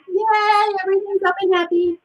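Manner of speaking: high, quick cheer; happy demo. Shown as a demonstration. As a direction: lift it up high, all yay. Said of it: "Go back to happy".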